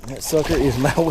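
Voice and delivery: usual speaking voice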